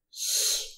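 ch as a soft palatal hiss; voiceless ch, like the H in "huge". Shown as a demonstration. Teacher showing learners the word-initial sound, soft hissed ch. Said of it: "we say".

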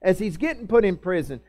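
ordinary talk, nothing marked

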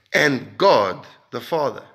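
ordinary talk

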